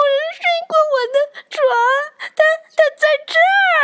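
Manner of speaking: high, fast whiny little cub; crying baby bear. Acted. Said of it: "熊宝宝大叫".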